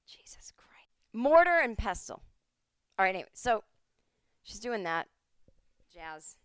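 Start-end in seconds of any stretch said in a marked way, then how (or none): 0.1-0.9 whispered under the breath; whispered exasperation. Real, not acted. Exasperated at own forgetting. 1.1-2.2 high, loud eureka; triumphant recall. Real, not acted. Finally remembers the word.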